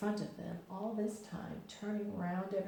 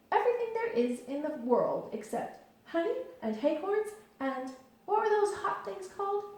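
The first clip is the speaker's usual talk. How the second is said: higher, louder, eager bouncing Tigger; bouncy Tigger; 12 semitones higher, 6 dB louder than usual. A narrator's reading. Tigger explains what he likes to Christopher Robin, eager bouncy voice. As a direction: bounce through it, full of himself.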